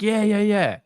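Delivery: high, loud eager yeah yeah yeah; eager woman. Retold or imitated. Woman at a barbecue admits her Ripple love, eagerly. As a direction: play her gushing proudly about her coin.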